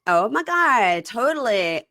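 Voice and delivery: mock Valley Girl exclamation. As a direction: do a quick Valley Girl gush. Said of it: "with the Valley Girl talk".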